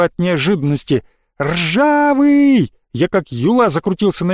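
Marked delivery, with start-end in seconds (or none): 1.4-2.7 high, drawn-out name call. A narrator's reading. Boy searching for his vanished friend, calling out. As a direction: call for someone out of sight.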